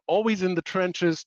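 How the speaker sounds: ordinary talk, nothing marked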